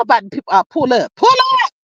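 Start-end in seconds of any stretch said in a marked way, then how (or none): 1.2-1.7 excited call. Real, not acted. Rallying the chat to court.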